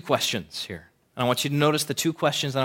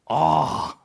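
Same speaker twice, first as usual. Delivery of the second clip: normal talking voice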